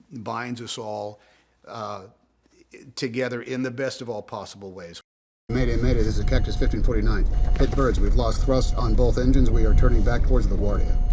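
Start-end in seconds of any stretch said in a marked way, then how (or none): 5.5-11.1 urgent loud distress call to tower; mayday radio call. Acted. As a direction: shout the mayday over engine noise. Pilot calls in engine failure.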